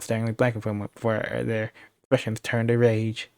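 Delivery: normal talking voice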